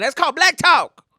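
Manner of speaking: very high, loud title call; mock segment announcement. Presenting a new segment to viewers, loud mock announcement.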